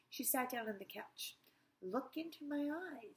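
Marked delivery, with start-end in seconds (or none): 1.8-3.2 hypnotist voice. Acted. Hypnotist speaking slowly.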